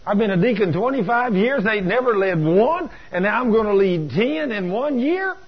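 high-pitched, rising disbelief; incredulous protest. Retold or imitated. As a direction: argue with yourself as if you've lost your mind. Incredulous at his own pledge.

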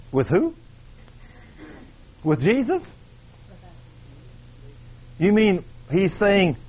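ordinary talk, nothing marked

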